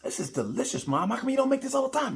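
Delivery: put-on high, fast and delighted, like a kid at a fancy dinner; gushing kid. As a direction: gush over mom's food in a high rushed voice. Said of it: "You're like".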